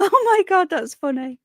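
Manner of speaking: laughing. Real, not acted. Cracking up at a viewer's comment, laughing. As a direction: laugh the words out.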